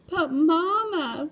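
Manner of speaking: high, slow drawn-out whine of "Mama"; moaning plea. A narrator's reading. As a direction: stretch it out like a begging kitten. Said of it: "we moaned".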